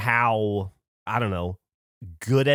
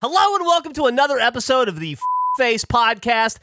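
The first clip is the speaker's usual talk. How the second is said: higher-pitched, drawn-out show announcement; podcast host intro; 7 semitones higher than usual. Real, not acted. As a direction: announce the show and hosts deliberately.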